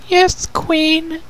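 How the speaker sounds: very high, small timid voice; hesitant princess. Acted. Youngest princess answering the queen, high and timid. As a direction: barely dare to answer. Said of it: "said after a moment's hesitation".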